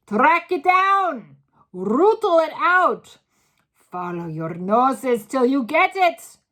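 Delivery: slow, very high, loud orders; loud commanding. A narrator's reading. Grand High Witch driving the hunt, slow loud orders. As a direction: hammer out each command.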